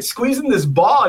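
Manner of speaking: usual speaking voice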